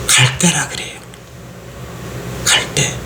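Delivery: hushed, breathy whisper. Real, not acted. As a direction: lean in and whisper the word. (속삭이듯 말하는 갈대)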